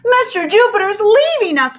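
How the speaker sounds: high, loud blurt. A narrator's reading. A girl blurting the news to the librarian, high and loud. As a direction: burst it out all at once. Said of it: "blurted Rose".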